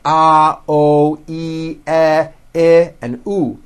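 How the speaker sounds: pure, held ah, oh, ee, eh, oo; demonstrating vowel sounds. Shown as a demonstration. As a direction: hold each vowel, ah to oo, steady and separate. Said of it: "we'll work with vowel sounds".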